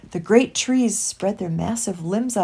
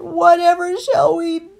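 high, loud, slow wail; drawn-out cry of despair; 12 semitones higher, 13 dB louder, slower than usual. A narrator's reading. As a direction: wail helplessly, stretching the words. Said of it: "cried Christopher".

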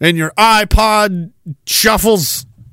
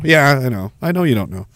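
ordinary talk

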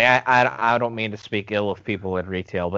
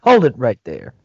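commanding shout; 2 semitones higher, 8 dB louder than usual. Retold or imitated. Quoting movie cop line.

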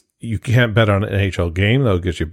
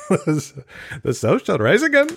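mock Southern drawl; 9 semitones higher, slower than usual. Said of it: "If you're doing the South".

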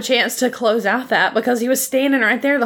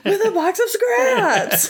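Jeff Bridges impression; 12 semitones higher, slower than usual. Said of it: "that Jeff Bridges delivery".

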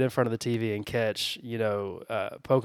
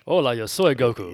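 Spanish dub Goku; 6 dB louder than usual. Retold or imitated. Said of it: "watching Dragon Ball in Spanish".

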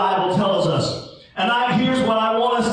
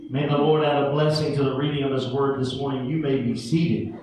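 scripture reading; 8 semitones lower, 3 dB quieter than usual. Acted. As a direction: read the Bible passage to the congregation. Opening scripture reading, measured.

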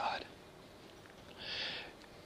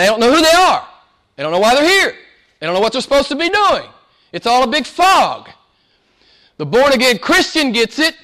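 higher preaching push; rising preaching; 10 semitones higher, 5 dB louder than usual. Acted. Preacher pressing the church about mankind's fog, higher. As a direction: build toward the point.

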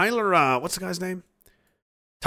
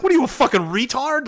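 mock scolding; 10 semitones higher than usual. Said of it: "you'd be like".

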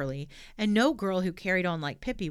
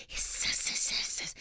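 whispered snake hissing. Acted. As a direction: hiss like a snake. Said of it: "he hissed".

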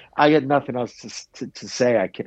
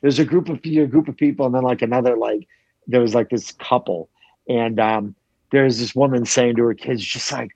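ordinary talk, nothing marked

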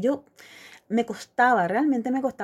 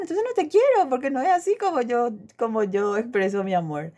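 exasperated retort; 6 semitones higher than usual. Retold or imitated. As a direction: hurl it back in frustration. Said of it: "me quedó esa frase".

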